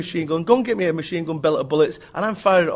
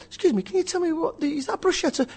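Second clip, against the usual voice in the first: lightly acted guest, pitched high; a high, polite inquiry at the buffet; networking guest; 10 semitones higher than usual. Lost newcomer asks the woman with vol-au-vents, high mock inquiry.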